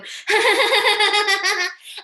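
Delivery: loud high burst of giggling; nervous laugh. Real, not acted. Man laughing nervously while recalling a flirty text. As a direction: laugh it off, embarrassed.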